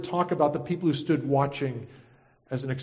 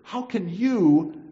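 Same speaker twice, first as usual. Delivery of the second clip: higher-pitched, indignant challenge; rebuking; 6 semitones higher, slower than usual. Acted. Dying criminal to the mocker, indignant rebuke. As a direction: press each question, slowing on the last. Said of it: "and yet he says".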